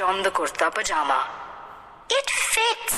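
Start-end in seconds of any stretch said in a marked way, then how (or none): 2.1-3.0 put-on high, delighted little girl; pleased child's high voice. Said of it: "very pleased".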